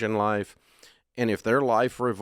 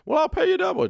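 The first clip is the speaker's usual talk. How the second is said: much higher, louder pushy offer; high pleading client; 5 dB louder, faster than usual. Retold or imitated. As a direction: squeak it like a begging client. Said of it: "client pleading, voiced by host".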